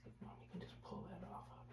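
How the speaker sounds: soft whisper, fast. Real, not acted. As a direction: whisper it under breath. Whispering while picking fleas.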